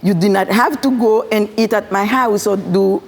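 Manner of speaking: usual speaking voice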